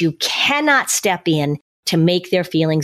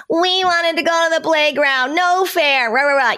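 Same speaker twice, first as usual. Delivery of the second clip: put-on high, whining kid voice, no fair; whiny disappointed child; 9 semitones higher than usual. Parent mimics a kid denied the playground, high and whining. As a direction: pitch up into a sulky child's protest. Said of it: "they're like".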